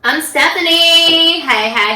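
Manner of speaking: high, loud, slow self-introduction; energetic intro. Real, not acted. Red Bull kicked in, high loud energetic intro. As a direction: announce your name with a burst of energy. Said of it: "You have a lot more energy today".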